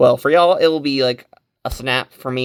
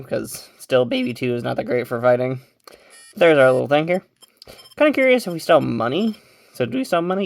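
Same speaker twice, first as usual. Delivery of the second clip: ordinary talk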